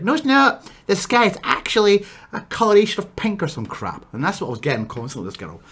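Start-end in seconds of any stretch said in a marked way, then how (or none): 0.0-3.4 high mocking whiny imitation of the ex; sneering high mock voice; mocking ex-girlfriend. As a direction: mimic her contradicting everything, pitched way up. Ex contradicting him, mocking quote.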